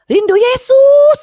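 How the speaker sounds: very high, drawn-out loud cry; full-voiced high shriek of longing; retold scream. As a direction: scream it out slowly, imitating her. Retold outburst, screamed answer.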